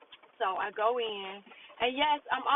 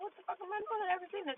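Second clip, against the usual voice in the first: high, softer re-enacted bewilderment; bewildered inner reaction; 9 semitones higher, 6 dB quieter, faster than usual. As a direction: act out being lost, pitched up and quieter. Bewildered first look at job.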